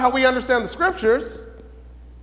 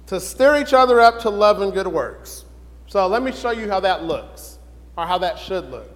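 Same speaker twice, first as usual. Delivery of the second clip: normal talking voice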